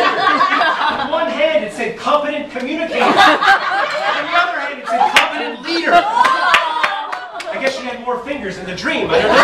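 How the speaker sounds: barely holding in a laugh; laughing while talking. Real, not acted. Laughs describing tattooed fingers.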